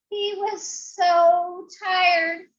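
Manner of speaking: very slow "so tired"; weary storytelling. A narrator's reading. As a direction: stretch it out like exhaustion. Dragon exhausted.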